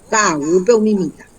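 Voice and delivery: normal talking voice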